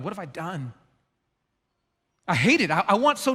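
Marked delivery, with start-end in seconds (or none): 0.0-0.7 nearly breathless soft regret; very soft remorse; remorseful hush. Acted. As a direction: let it fall out, ashamed. After losing temper with kids.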